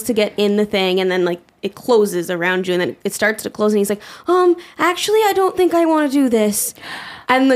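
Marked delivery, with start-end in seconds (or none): 4.3-6.7 put-on high-pitched, hesitant child backing out; nervous kid. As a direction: imitate a kid having second thoughts on a ride. Voices a scared kid backing out.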